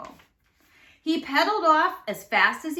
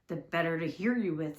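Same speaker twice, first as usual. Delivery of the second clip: lower, softer croaky wolf voice; a low, hushed rasp from the bed; raspy voice; 5 semitones lower, 5 dB quieter than usual. A narrator's reading. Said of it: "said the raspy voice".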